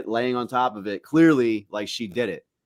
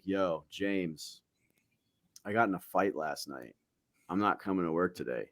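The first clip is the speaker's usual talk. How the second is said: lower, softer call-in voice; 5 semitones lower, 10 dB quieter than usual. Retold or imitated. Calling in sick to boss.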